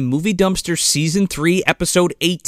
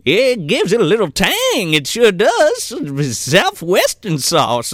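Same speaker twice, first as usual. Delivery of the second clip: higher, hammed-up character voice; put-on cook character; 4 semitones higher, slower than usual. Acting out the cook describing the sauce.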